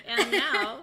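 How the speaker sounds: high, loud grand announcement; announcer voice. Retold or imitated. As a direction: announce it like a showman. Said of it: "that was just like".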